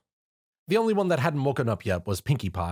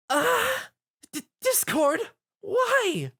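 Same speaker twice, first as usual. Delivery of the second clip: much higher, broken, tearful pleading; sobbing; much slower than usual. Acted. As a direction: cry out at a friend's betrayal. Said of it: "Fluttershy sobbed".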